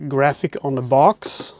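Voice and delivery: usual speaking voice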